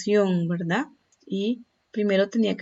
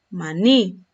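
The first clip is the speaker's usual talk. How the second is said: maní with a louder final NÍ; stress on last syllable; 5 dB louder than usual. Shown as a demonstration. Teacher showing an aguda example, stress on the last syllable of maní. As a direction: hit the end of maní, ma-NÍ. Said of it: "el acento lo tiene en la".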